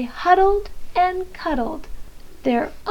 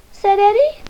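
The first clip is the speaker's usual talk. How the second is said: ordinary delivery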